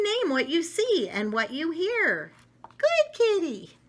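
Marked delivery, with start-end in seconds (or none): 2.6-3.8 high, slow coo; sweet character voice. Acted. Picture book child praising the cat. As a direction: coo at the kitty.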